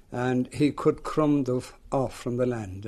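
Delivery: ordinary talk, nothing marked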